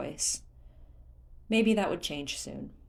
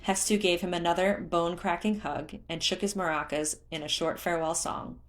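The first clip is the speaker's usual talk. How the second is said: ordinary delivery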